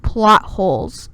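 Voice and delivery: loud, slow and emphatic; exasperated exclamation. Real, not acted. Reader fed up with the story's logic, loudly exasperated. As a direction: call it out loudly, drawing out both words.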